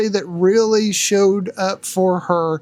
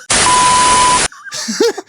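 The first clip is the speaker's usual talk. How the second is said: loud high laugh; 3 dB louder than usual. Real, not acted. Laughing at own outburst.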